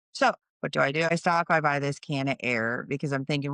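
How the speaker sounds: ordinary talk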